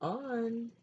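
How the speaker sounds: put-on calling a child's name across the house. A narrator's reading. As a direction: call him to dinner from the kitchen. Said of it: "grandfather called out".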